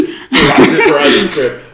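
breaking up in giggles; laughing. Real, not acted. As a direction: laugh through it, out of character.

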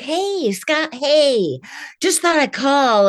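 high, bright fake phone friendliness; fake friendly caller. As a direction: sound like a too-friendly robocall. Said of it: "I hate when they're like".

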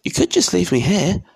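put-on high, tentative boy's voice; hopeful boy. A narrator's reading. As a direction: pipe up hopefully, pitched high. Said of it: "Harry put in hopefully".

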